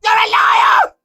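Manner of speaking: shrieking accusation. Real, not acted. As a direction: scream it at him. Accusing stranger of lying.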